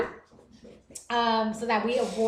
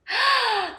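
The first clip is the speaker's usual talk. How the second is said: sharp, high gasp; shocked gasp; 12 semitones higher, 5 dB louder than usual. Real, not acted. Streamer hears viewer's accent was insulted, shocked gasp. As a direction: gasp in loud disbelief.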